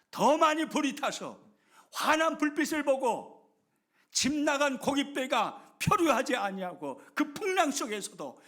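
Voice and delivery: loud, high, fervent preaching; preaching at full force. Acted. Pastor proclaims God's different way to the congregation, at full force. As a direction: drive the point home from the pulpit.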